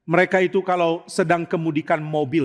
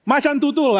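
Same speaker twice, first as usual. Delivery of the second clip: high, raised comic insult; mock cursing; 10 semitones higher, 4 dB louder than usual. Preacher swearing with zoo animal names, mock cursing. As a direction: spit it out as a pretend swear. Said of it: "akan memaki dengan".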